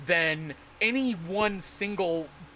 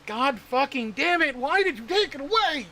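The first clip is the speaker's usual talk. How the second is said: exasperated outburst; 7 semitones higher than usual. Real, not acted. Streamer venting at Dev about the change, exasperated high outburst. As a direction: pitch jumps high in exasperated disbelief.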